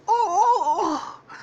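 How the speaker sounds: high, loud strangled-victim cries; hammed-up cartoon wails of distress; suffocating cries. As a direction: cry out as if being smothered. Shaggy being suffocated.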